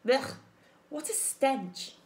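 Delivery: slow, loud disgusted badger voice. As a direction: recoil from the stench, slowly. Badger disgusted by rotten corpse.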